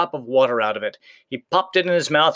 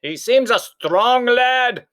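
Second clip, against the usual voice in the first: uneasy giant; 11 semitones higher, 5 dB louder, much slower than usual. A narrator's reading. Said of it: "said Cuchulainn".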